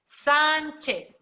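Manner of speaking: "Sánchez" with a high, drawn-out SÁN; stress on first syllable. Shown as a demonstration. Teacher showing where the accent goes, "Sánchez" stressed on SÁN. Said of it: "qué otro acento va".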